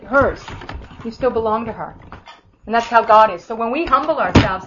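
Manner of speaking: usual speaking voice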